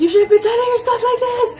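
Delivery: high, loud retold yelling at him; mom screaming. Mom yelling at husband for telling her, high and loud. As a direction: shriek it at the husband like an angry mother. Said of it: "mom goes berserk screaming at him".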